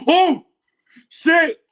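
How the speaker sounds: high, loud gasp breaking into a laugh. Real, not acted. Watching the trailer live, a gasp turning into laughter. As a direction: caught off guard and thrilled.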